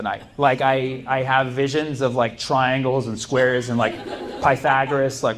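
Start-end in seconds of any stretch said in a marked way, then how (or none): none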